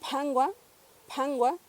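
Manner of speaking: pangua with a puff of air after the p; aspirated p. Shown as a demonstration. Teacher giving a Purépecha word with aspirated p. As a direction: breathe out on the p of pangua. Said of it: "lo tenemos en palabras como".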